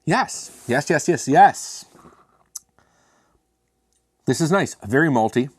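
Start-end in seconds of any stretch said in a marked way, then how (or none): 0.0-1.9 delighted yes. Real, not acted. First sip of the beer.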